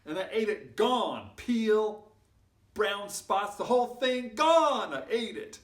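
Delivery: loud, high, emphatic boasts; triumphant storytelling. A narrator's reading. Storyteller boasts the banana is gone, loud and triumphant. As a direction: punch each bit out triumphantly.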